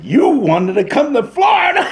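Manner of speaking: high-pitched shouted jab; retold outburst to a stage partner; exasperated aside onstage. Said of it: "I turned upstage. I said".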